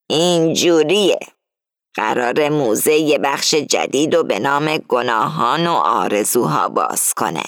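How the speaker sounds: steady and confiding, a hint of a plotting old lady; Marta's voice. A narrator's reading.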